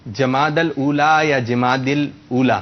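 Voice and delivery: "جماد الاولا" slowly as jamaadal-oola, then jamaadil-oola; two pronunciations contrasted. Shown as a demonstration. Host quizzing on the correct month name, two pronunciations contrasted. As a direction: give "جماد الاولا" first with -dal, then with -dil. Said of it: "اس کا درست تلفظ ہے".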